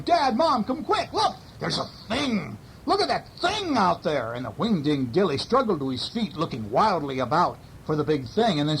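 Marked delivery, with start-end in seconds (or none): 0.0-4.3 high, excited shouts to his parents; boy shouting. A narrator's reading. Boy calling parents to see the creature, high excited shout. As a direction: yell for Mom and Dad to come see. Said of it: "Orvy was shouting".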